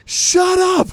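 sudden high yell, losing it; furious shout. Retold or imitated. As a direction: snap and scream it at him.

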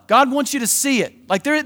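ordinary talk, nothing marked

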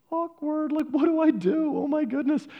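high squeaky panicked voice; comic high-pitched panic; flustered greeter. Retold or imitated. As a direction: jump way up in pitch, all flustered. Greeter flustered by crying man.

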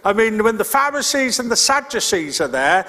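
ordinary talk, nothing marked

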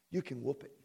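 ordinary talk, nothing marked

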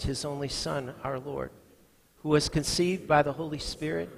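loud, steady recited creed; creed recitation. Acted. Pastor leading the church in the Apostles' Creed, full-voiced. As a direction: declare it together, full-voiced.

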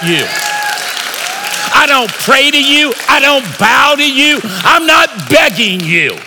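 rousing, forceful preacher's declaration; impassioned preaching. Acted. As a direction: throw down a challenge to the nation.

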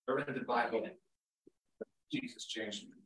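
low, quiet gruff grumble. Retold or imitated. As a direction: mutter it curt and low. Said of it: "used this gruff voice".

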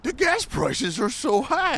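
hammed-up celebrity impression, very slow; Adam Sandler impersonation. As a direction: stretch every word like a dopey comedy character. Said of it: "you sound like Seth Rogen".

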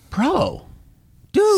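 rising into a high, fed-up "dude"; exasperated. Retold or imitated. Upstairs neighbor stomping.

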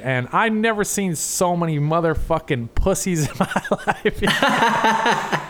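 breaking into laughter while quoting; laughing punchline. Retold or imitated. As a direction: crack up as you land the quote. Cracks up retelling uncle's joke.

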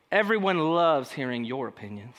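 low-pitched, insincere praise; condescending. Shown as a demonstration. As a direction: praise them while meaning the opposite, voice dropped low. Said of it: "When someone speaks to you that way".

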